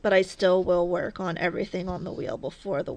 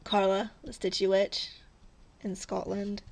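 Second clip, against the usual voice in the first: normal talking voice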